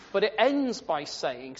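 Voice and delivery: ordinary talk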